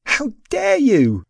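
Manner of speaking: high, loud, drawn-out indignation; wailing indignant protest; outraged wail. A narrator's reading. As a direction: cry out slowly in high-pitched outrage. Heroine's outraged cry after wailing.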